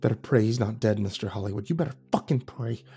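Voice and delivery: low-volume, menacing hiss; hissed threat. A narrator's reading. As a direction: threaten him under your breath. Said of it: "and hissed".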